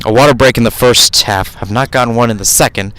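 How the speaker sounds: ordinary talk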